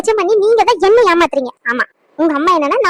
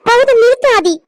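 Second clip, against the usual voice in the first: raised, higher-pitched command to stop; angry cut-off; 4 semitones higher, 4 dB louder than usual. Acted. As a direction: cut him off in anger. Quarrel, cutting him off. (கோபமாக நிறுத்தச் சொல்லும் குரல்)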